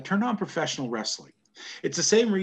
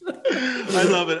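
laughing through the words; 6 semitones higher, 5 dB louder, slower than usual. Real, not acted. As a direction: chuckle out the insult, amused. Laughs at the hoser joke.

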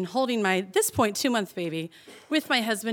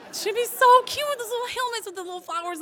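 much higher, cutesy gushing voice; 13 semitones higher than usual. Retold or imitated. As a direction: swoon over the little flowers. Imagining cute baby helmets.

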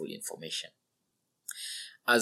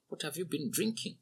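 a light, raised, softer question with drinking rising; asked lightly; 8 semitones higher, 4 dB quieter than usual. A narrator's reading. Old man lightly asking the drunk laborer. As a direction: ask it with easy curiosity. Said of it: "He asked lightly".

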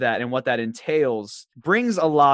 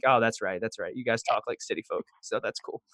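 softer, trailing away; mock concession; 3 semitones lower, 7 dB quieter than usual. Conceding to Charlotte city folk, softly mock-agreeing. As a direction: back down quietly, playing along.

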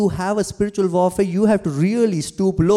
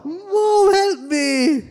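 much higher mock little boy; mock whine of a hurt child; child crying for mom; 14 semitones higher, much slower than usual. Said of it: "he's crying to his mom".

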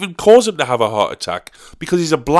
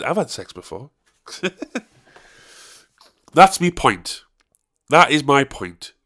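ordinary delivery

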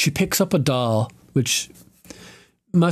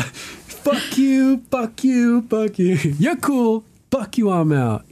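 high goofy voice; mock a stoner quitting his job with a squeal; Half-Baked Scarface; 11 semitones higher, slower than usual. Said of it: "Scarface, that great guy".

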